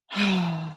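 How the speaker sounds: soft, breathy sigh. Real, not acted. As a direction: let out a short sigh. Reacts to argument while reading texts.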